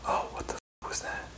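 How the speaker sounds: hushed, startled whisper. Real, not acted. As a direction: breathe it out in shock. Startled by a noise in the dark.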